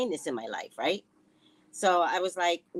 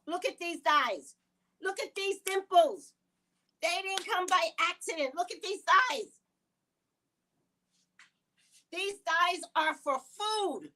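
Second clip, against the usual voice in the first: sassy boast; 9 semitones higher than usual. Real, not acted. Playful boastful show-off.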